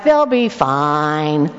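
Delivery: low, slow, unbothered brush-off; dismissive drawl. Retold or imitated. Husband brushing off his wife's worry, low slow unbothered. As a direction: stretch it out like nothing could go wrong.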